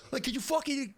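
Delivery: high-pitched mock Boston indignation; obnoxious Southie. Game master continues the Bostonian horror bit, mock-indignant Southie. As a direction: start an indignant rant in a put-on Boston accent. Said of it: "straight up obnoxious Southie".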